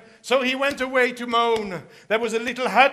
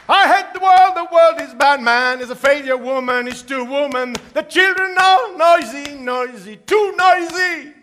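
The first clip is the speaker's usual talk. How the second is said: loud, higher grumbling lament; loud moaning rant; 3 semitones higher, 6 dB louder than usual. Acted. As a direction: whine at the world and the woman. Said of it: "off he went moaning".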